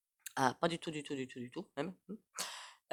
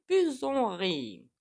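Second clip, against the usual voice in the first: high, loud, stretched-out "plus on rit"; drawn-out; 6 semitones higher, 5 dB louder, much slower than usual. Real, not acted. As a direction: draw out "plus on rit" long and high, falling at the end. Comic drawn-out end of a saying.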